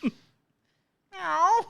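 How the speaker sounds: high, drawn-out meow with a rising end; mock dog meow. Pit bull answering the owner, high rising meow. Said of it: "he's just like".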